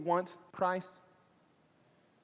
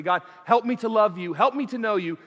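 loud, raised plea to God; crying out in prayer; 5 semitones higher, 7 dB louder than usual. Acted. Preacher models a sinner's prayer for mercy, crying out. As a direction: plead fervently, voice lifted high. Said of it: "you wanna cry out for forgiveness and mercy".

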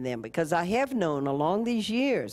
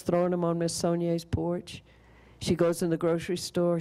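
normal talking voice

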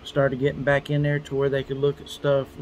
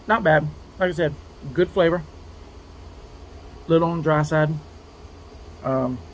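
talking while chewing food; words around a mouthful; mouth full, chewing; 2 semitones higher than usual. Real, not acted. Seen 3: Bites food at -2, holds and chews pieces while talking.

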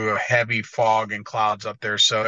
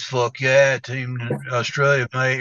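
Australian cheer; slower than usual. Retold or imitated. Mimicking an Australian friend bragging about Team Australia, in an Australian accent. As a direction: cheer it like an Aussie mate. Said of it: "he goes".

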